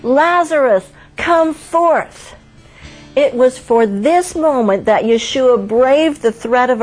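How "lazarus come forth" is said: high, ringing call of a name; loud cry. Acted. Jesus calls dead Lazarus out of the tomb, high loud cry. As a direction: call him out of the grave, high and strong. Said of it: "he cried with a loud voice".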